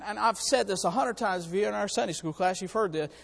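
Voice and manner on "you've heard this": usual speaking voice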